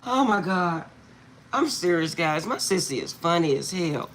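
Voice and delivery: normal talking voice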